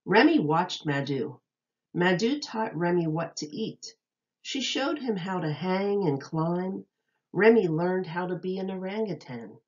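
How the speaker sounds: bright, lilting read-aloud voice; storybook reading voice; reading to children. Acted. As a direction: read it like a picture book to little kids. Reads picture book to children.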